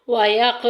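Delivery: व यक with the क released loose and echoing; qalqala bounce. Shown as a demonstration. Teacher showing students the qalqala on यक, loose echoing release. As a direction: let the क in यक bounce and ring out. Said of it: "कलकला हो रहा है इधर।".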